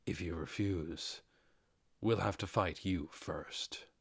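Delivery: a hint of quiet menace to chill her; dark voice. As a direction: let the threat sink in slowly. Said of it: "His dark voice gave Krush chills".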